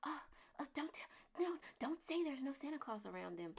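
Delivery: high whispered fretting; mock a worried parent hushing others; mock hushed parent. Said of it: "they're still like".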